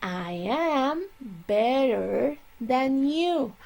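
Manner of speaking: slow model sentence; correct version, slowly. Shown as a demonstration. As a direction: drag out the right sentence for the class. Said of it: "You have to say".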